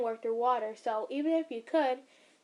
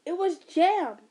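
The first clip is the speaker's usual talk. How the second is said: loud; 6 semitones higher, 7 dB louder, slower than usual. Acted. Defensive quote in story.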